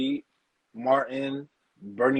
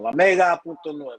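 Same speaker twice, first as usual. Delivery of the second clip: radio announcer; 4 semitones higher, 4 dB louder than usual. Retold or imitated. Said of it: "they always got the Spanish radio station on".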